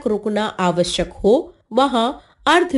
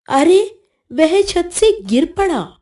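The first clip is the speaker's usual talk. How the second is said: alarmed exclamation; 6 semitones higher than usual. Shown as a demonstration. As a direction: exclaim it as if someone just fell. Exclamation example, someone falls off roof.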